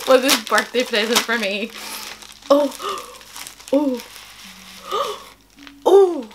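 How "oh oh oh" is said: small, high, hushed squeak; overwhelmed, high little voice; emotional excitement. Real, not acted. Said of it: "I didn't think I'd be this emotional".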